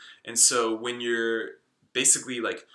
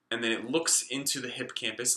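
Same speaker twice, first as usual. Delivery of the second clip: ordinary delivery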